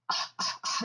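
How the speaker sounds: imitated choked, voiceless stutter; speechless stammer. Woman imitating her neighbor unable to answer, voiceless stammer. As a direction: gasp out broken starts of a word. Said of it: "couldn't say anything".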